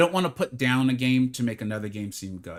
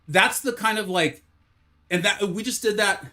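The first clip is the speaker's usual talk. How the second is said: infomercial voice; 8 semitones higher, 4 dB louder than usual. Pretend. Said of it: "I know I sound like an infomercial".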